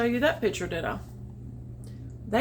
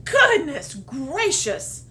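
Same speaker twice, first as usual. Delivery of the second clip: surprised bunny; 4 semitones higher, 5 dB louder, slower than usual. Acted. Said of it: "said the bunny".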